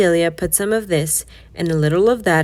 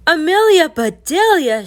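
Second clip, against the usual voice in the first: dismayed call; 10 semitones higher, 5 dB louder than usual. Acted. Mrs Rogers calls out in dismay.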